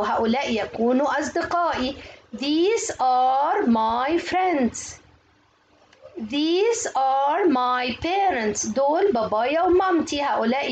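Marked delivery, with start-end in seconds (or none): 2.4-5.0 very slow, stretched-out English words; slow model reading. Shown as a demonstration. Teacher models a textbook sentence for pupils, very slow modelling. 6.2-8.7 very slow, stretched-out English words; slow model reading. Shown as a demonstration. Teacher models a textbook sentence for pupils, very slow modelling.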